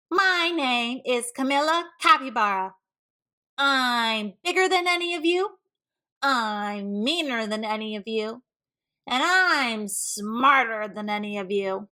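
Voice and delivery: loud boastful capybara voice; big bully announcing. Acted. Camilla barging into class, loud boastful bully voice. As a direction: boom it out, big and bossy. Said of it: "barged into the classroom and announced".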